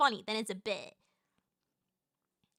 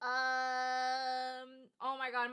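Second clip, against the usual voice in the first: usual speaking voice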